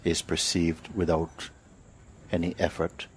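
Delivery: guided meditation. Real, not acted. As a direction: lead them unhurriedly, phrase by phrase, into awareness.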